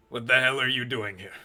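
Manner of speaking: loud, annoyed bark at an intruder; gruff chef. Acted. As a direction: snap at the stranger in his kitchen. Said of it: "he looks at you and goes".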